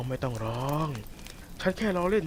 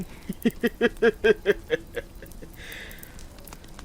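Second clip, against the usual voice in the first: airy laugh; 8 semitones higher than usual. Acted. Wolf gloats over his desire.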